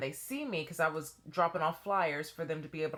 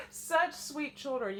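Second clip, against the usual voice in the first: ordinary talk, nothing marked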